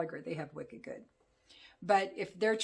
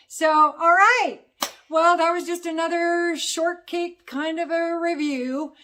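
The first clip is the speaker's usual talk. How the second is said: theatrical sign-off; 10 semitones higher, 12 dB louder than usual. Real, not acted. Reviewer closing another short review for viewers, loud and theatrical. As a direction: belt out the wrap-up to the viewers.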